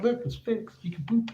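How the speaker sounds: high, playful retold reassurance; light teasing sing-song; mock proud announcement. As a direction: pitch it up like showing off a fixed toilet. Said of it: "I'm like".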